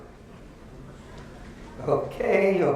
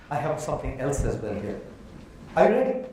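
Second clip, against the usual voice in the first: ordinary talk, nothing marked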